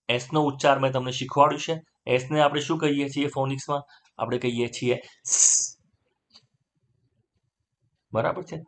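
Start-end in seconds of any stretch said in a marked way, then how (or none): 5.3-5.8 સ as a long voiceless hiss; only air. Shown as a demonstration. Teacher shows the phonics sound, airy hissed સ. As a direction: let only air out for સ, no vowel. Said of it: "હવા ખાલી કાઢવાની છે".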